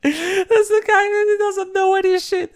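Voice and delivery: high, laughing squeal of disbelief; laughing high at the absurd clip. Real, not acted.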